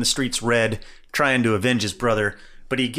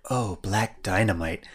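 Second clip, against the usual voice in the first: breathy lovestruck sigh; swooning woman; 4 semitones lower, 5 dB quieter, slower than usual. Retold or imitated. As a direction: swoon over him. Women swooning over the hero.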